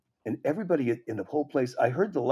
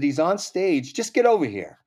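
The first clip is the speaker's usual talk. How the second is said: higher, louder insistence; urgent retold call; 5 semitones higher, 6 dB louder than usual. Club caller urging comedian to come over, insistent and raised. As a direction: press them to come at once.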